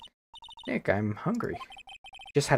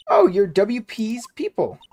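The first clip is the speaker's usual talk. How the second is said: nervous assistant voice; 10 semitones higher, 7 dB louder than usual. Acted. Assistant recognises them.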